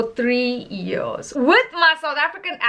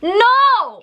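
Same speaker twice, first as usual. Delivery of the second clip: loud, high exclaimed refusal; raised-voice, emphatic rejection; emphatic denial; 9 semitones higher, 6 dB louder than usual. Real, not acted. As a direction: shoot the idea down loudly. Answers own rhetorical question.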